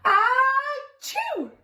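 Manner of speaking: high, hammed-up achoo sneeze; big sneeze. Narrator reading to child, acting out the sneeze. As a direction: act out an almighty sneeze.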